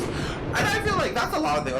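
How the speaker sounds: rising exasperated shout; heated outburst. Real, not acted. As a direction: let it burst out in frustration. Heated rant about fans.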